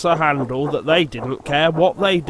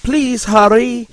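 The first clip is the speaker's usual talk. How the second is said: urgent pleading; 9 semitones higher, 6 dB louder than usual. Acted. Engine urging on.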